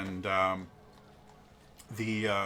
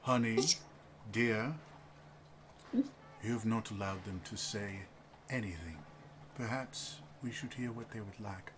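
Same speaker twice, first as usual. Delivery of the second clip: reserved husband; 8 dB quieter than usual. Acted. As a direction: gently rein in your chatty wife.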